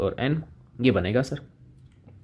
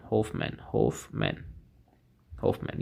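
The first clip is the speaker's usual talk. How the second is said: slow, whispered prompt; hushed repetition; 3 semitones lower, 10 dB quieter, slower than usual. Real, not acted. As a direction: whisper the name slowly, jogging the students' memory. Softly repeats the name as recall hint. (फुसफुसाकर, धीरे-धीरे याद दिलाते हुए)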